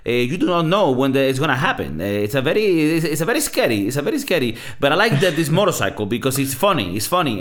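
put-on animated, lilting Italian-accented English. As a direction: imitate an Italian racer explaining himself in excited English. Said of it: "you gotta love the Italian English accent".

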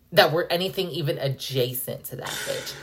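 ordinary talk, nothing marked

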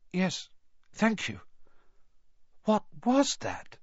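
put-on small, unsure boy just rescued; young Harry, shaken. A narrator's reading. Harry thanks the centaur, a lightly voiced shaken boy.

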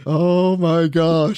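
imitating her loud, drawn-out exclamation; laughing exclamation. Caller cracks up at being told to say his name, loud exclamation. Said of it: "she starts laughing".

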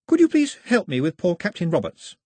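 put-on high, light young girl voice, anxious and polite; a young castaway girl fussing over her guest; girl's voice. A narrator's reading. Girl offering scones to her guest, high and eager.

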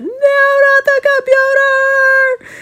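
high, loud, drawn-out cry of dismay; scream. Acted. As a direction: wail it as the mom sees juice on her laptop. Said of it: "she screamed".

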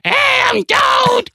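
very high, slow, shouted Toad squeak; squeaky Toad impression. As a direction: squeal it like a tiny mushroom man. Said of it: "he's like".

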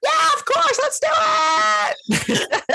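very high squeaky reply. Pretend. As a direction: answer in a tiny squeak. Answering the bro voice, squeaky.

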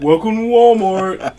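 lightly voiced mocking caricature. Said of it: "stereotype of, like, someone with mental health issues".

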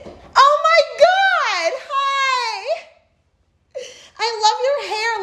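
mock gushing greeting. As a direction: gush like a fake-friendly fan.

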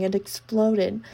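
ordinary delivery, nothing marked

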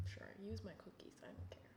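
breathy whisper to herself; whispering while clicking through a website's cookie pop-up; whispered aside. Real, not acted. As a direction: whisper it offhand to the screen.

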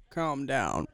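low imitated "calm down"; calming stepdad. Imitating the stepdad reacting to the woo, low and calming. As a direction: drop low, as a mock stepdad. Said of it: "It's almost like he goes".

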